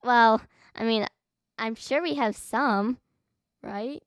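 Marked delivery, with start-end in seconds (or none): none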